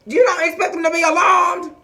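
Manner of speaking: shouted high, disbelieving; incredulous shout. Acted. Recapper yells at Autumn in disbelief. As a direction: yell it in disbelief at her.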